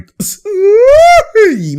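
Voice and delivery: loud, very high drawn-out shriek; high-pitched squeal. Real, not acted. Reacting to Boban's play, a high excited shriek.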